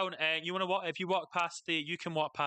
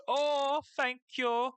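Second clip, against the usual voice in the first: grateful Lyra; 12 semitones higher, 5 dB louder, much slower than usual. Acted. Lyra hugs Isla gratefully.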